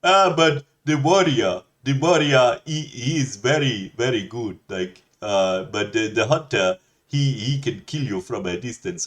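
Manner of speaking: put-on Indian accent. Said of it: "And he'd be like".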